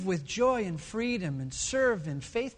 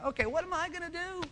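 high, softer, giddy question; giddy at being left alone; gleeful; 9 semitones higher, 4 dB quieter than usual. Acted. King gleeful, alone in town.

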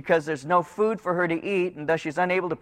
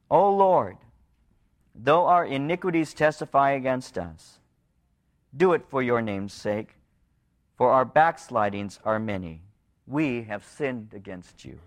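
scripture reading; 4 semitones lower than usual. Acted. Preacher reading the people's plea, low and prayerful.

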